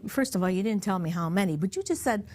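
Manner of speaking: ordinary talk, nothing marked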